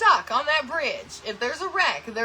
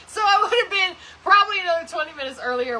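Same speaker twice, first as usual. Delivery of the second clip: laughing; 7 semitones higher, 6 dB louder, faster than usual. Real, not acted. Admitting to viewers she dawdled, laughing. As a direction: talk fast through a laugh, higher. Laughing through her confession.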